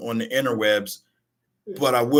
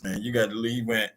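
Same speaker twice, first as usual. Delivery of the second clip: ordinary talk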